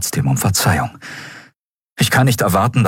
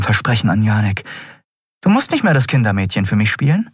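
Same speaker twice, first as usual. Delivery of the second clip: higher, earnest warning voice; serious captain; 10 semitones higher than usual. Acted. Captain warning Miko it is no game, earnest voice. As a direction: make him feel the danger.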